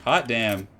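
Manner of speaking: usual speaking voice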